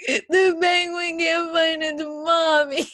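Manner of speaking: loud, high, drawn-out blubbering; mock tearful wail. New mother explains her tears to ex-husband, mock tearful wail. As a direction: wail it slowly like a distraught child. Acts out her hormonal crying fit.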